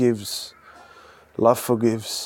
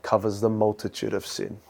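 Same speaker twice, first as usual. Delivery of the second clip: usual speaking voice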